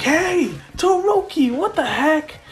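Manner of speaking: high-pitched outraged call; acted squawk of complaint; indignant protest. Racers protest from behind.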